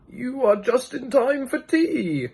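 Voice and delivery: put-on high, slow old man's voice; a slow, high, courteous old man; second old man. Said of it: "said the second".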